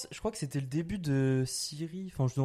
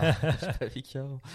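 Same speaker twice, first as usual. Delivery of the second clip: en riant. Real, not acted. Amusé par sa propre phrase.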